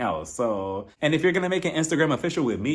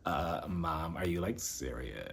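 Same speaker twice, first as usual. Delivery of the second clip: much lower, soft mock son voice; lower, quieter, lazy teen boy voice; imitating Brooks; 9 semitones lower, 13 dB quieter, slower than usual. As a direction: drop way down and murmur like the teen son. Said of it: "he said it perfectly for all of us".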